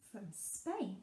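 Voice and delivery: ordinary delivery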